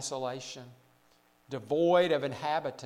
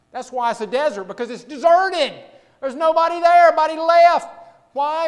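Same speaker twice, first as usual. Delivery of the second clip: raised, louder preacher's voice; emphatic preaching; 11 semitones higher, 13 dB louder than usual. Real, not acted. Preacher explaining why a desert is deserted, emphatic. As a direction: drive the point home, climbing higher.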